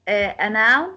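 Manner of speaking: ordinary talk, nothing marked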